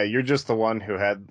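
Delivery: usual speaking voice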